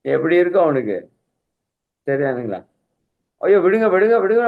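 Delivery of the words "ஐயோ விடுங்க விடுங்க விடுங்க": acting out a drowning man's panicked plea, higher and louder; mock frantic yell, pitched up; imitated desperate cry. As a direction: plead loudly to be let go, mimicking panic. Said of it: "அப்படியே கதறான், அலறான்".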